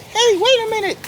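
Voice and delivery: high little animal voice, eager to help; little muskrat. Acted. Muskrat speaks up.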